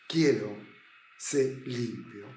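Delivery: gentle Jesus. Acted. Jesus answers the leper.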